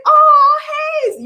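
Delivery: very high, thrilled oh hey; squealed hello to a butterfly; delighted greeting. Retold or imitated. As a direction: greet the butterfly like an old friend. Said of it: "And I was like".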